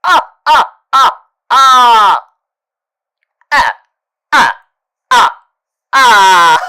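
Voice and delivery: loud, high cackling "ah ah" laughter; maniacal laugh. Acted. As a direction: cackle like a gleeful villain. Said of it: "I do love the sound of my own laugh".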